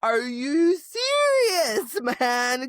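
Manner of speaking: high, drawn-out incredulous outburst; exasperated mock outrage. A reader scolding Tamlin for being clueless, mock outrage.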